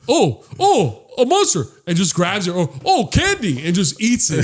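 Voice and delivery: high, silly voice of a guy grabbing whatever he sees; mocking a grabby guy.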